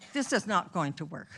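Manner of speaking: ordinary delivery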